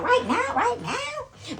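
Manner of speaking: high urgent repeat. Retold or imitated.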